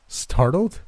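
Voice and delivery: high, rising disbelief on STARTLED; incredulous echo. A narrator's reading. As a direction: throw "Startled" back as a disbelieving question. Incredulous echo of friend's word.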